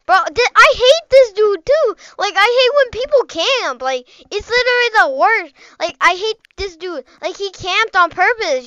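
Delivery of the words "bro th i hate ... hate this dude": high, loud frustrated complaint; annoyed rant. Real, not acted. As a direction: vent your irritation, pitch raised. Annoyed rant about a camper.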